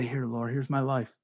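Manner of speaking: quiet prayer. Retold or imitated. Preacher voicing a believer handing his life to God, hushed.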